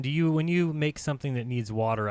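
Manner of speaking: ordinary talk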